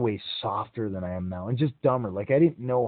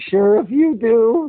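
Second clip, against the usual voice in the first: exhausted; 11 semitones higher, 10 dB louder, much slower than usual. Retold or imitated. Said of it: "I was so wiped out, I could barely talk".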